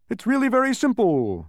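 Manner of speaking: high, loud, showy assurance; confident pronouncement. A narrator's reading. Humbug twirling his cane, confident pronouncement. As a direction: declare it as if utterly obvious.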